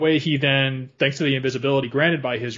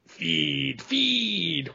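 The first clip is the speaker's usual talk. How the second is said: spider voice; 3 semitones higher, 3 dB quieter than usual. Retold or imitated. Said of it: "he hears the spiders chattering in dark speech".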